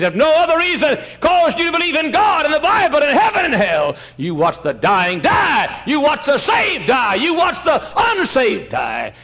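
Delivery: high, loud preacher's cry that hammers each word; preaching at full force. Acted. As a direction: drive it home from the pulpit. Preacher pounding on the dying.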